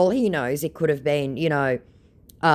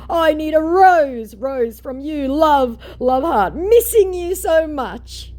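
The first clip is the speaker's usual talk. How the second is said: much higher, louder, syrupy and pining; mock fake Keanu; 13 semitones higher, 7 dB louder than usual. The fake Keanu pining for her rose, high and syrupy. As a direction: pine theatrically. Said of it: "is the format".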